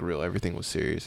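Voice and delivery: ordinary delivery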